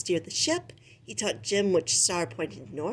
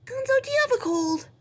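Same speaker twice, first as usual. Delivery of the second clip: much higher, fast squeak; squeaky rat; faster than usual. Acted. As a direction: ask it in a quick rat voice. Rizzo asks Gonzo.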